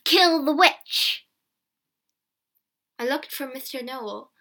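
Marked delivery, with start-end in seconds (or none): 0.0-1.3 slow, high, loud mob chant; shouting. A narrator's reading. Villagers run after the horses, shouting. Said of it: "ran after the horses and shouted".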